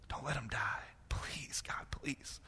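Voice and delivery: breathless whispered begging; whispered plea. Retold or imitated. Grandson praying at night, whispered plea. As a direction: whisper the last please. Retold prayer fading to whisper.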